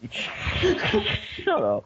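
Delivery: high, laughing yelp; laughing shout. Real, not acted. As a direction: squeal it through laughter. Laughing outburst at friend.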